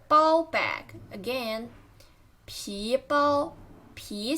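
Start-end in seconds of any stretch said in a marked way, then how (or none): 3.9-4.4 皮 with a rising pitch; careful rising tone on 皮; second tone. Shown as a demonstration. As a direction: lift the pitch through 皮. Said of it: "second tone".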